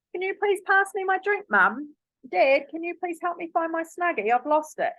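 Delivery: put-on high, sweet little-kid asking voice; polite child request. Shown as a demonstration. Mum modelling a polite request for her daughter, high and sweet. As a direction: model the nice way a child should ask. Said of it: "say it how I want them to say it".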